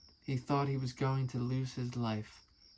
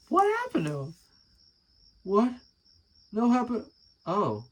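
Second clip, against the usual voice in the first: high, loud disbelieving cries; shocked; 9 semitones higher, 7 dB louder than usual. Real, not acted. Rereading own book, loud surprised outburst. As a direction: caught off guard, voice shooting up. Finds missing character, shocked.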